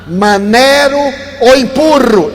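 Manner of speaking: a mild mock shout from across the line; calling out. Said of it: "ele grita de lá". (chamando de longe, meio gritado)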